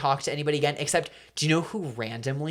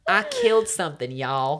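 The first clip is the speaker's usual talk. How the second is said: Natalie. Retold or imitated. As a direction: imitate a distressed Southern castaway. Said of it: "She's like".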